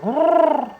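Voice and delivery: high owl hoot; owl call. A narrator's reading. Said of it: "Owl said".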